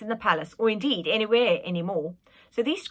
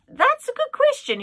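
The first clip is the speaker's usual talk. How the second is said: put-on cheeky boy voice; 8 dB louder than usual. Boy voiced high and loud.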